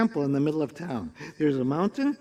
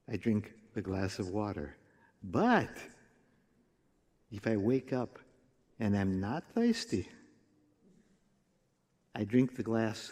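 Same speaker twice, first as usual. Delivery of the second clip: lightly voiced sage; 8 semitones lower, 8 dB quieter than usual. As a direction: explain it low and measured, with pauses. Wise fool solemnly explains his logic.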